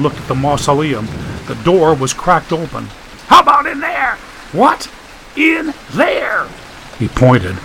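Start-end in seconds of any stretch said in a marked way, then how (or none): 3.3-6.4 much higher strained yell; Staples shouting. Acted. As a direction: complain at full voice into the wind.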